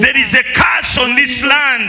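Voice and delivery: slow shouted proclamation; laying a curse. Acted.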